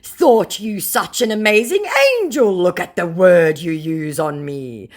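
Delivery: hammed-up scammer hurt and indignant; mock scammer. Scammer reproaching the target's insult, mock indignation. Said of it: "said the scammer".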